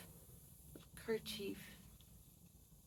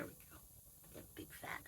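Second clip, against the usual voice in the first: whispered to the pet; soft whisper to the animal; 23 dB quieter than usual. Real, not acted. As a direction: breathe it out unvoiced.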